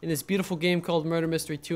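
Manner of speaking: ordinary talk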